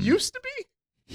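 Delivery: squeaky high, slow disbelief; high drawn-out gasp of disbelief; mock shock.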